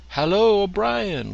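high, loud hearty hello; bright loud welcome to a friend; cheery greeting. Acted. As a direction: hail him warmly across the room.

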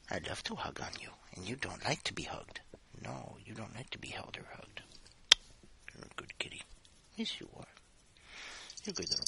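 soft unvoiced whisper. Real, not acted. Owner cooing to the cat she holds, hushed whisper. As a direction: hush it close to the cat's ear.

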